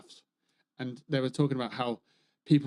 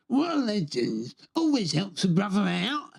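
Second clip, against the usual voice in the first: Ragman voice; 4 semitones higher than usual. Acted. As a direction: make him sound like a legend who helps everyone out. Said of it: "And that's how he sounds".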